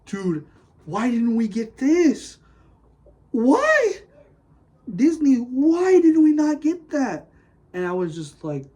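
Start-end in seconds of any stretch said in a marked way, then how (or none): 0.0-7.3 building to a high, loud wail; exasperated protest. Retold or imitated. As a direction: plead in mock anguish, stretching the words. Acts out protest at Disney.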